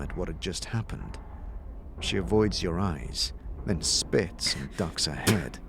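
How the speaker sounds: soft, hushed scene narration; game master narration. A narrator's reading. Game master describing the tower to the players, hushed. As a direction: draw them toward the tower.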